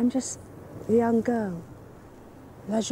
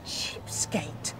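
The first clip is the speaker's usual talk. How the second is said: muttered low put-down; scornful whisper; 8 semitones lower, 8 dB quieter than usual. Acted. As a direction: whisper the insult. Woman mocks doctor's offer.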